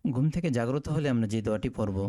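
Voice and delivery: usual speaking voice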